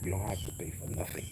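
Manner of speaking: soft hushed whisper, slowed into a near-breath. Pretend. Son promising mom she pays nothing, whispering. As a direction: let the promise drop to a whisper.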